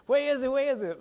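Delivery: high and loud, urgent; frantic searching. Retold or imitated. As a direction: call out looking around worried. Friend frantically looking for crash.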